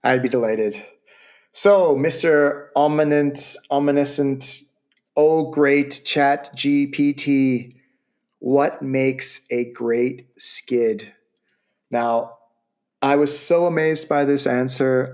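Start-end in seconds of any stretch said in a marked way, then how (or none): 1.5-11.1 mock solemn. Solemnly addressing ChatGPT like oracle.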